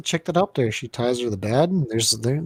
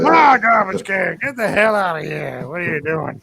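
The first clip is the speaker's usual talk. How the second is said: grumpy bum; 5 semitones higher than usual. Retold or imitated. Voicing the bum guarding his can, mock cranky. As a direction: shoo them off like a territorial hobo.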